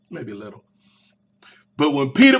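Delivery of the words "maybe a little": sheepish aside. Real, not acted. Sheepish admission after gangster bit.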